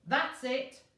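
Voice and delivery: loud high furious cry; angry outburst. A narrator's reading. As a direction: explode at her. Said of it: "cried the man".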